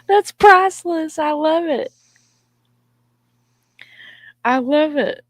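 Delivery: high, laughing exclamation; real laughing squeal of delight; amused delight. Real, not acted. Amused by friend's cockatiel story.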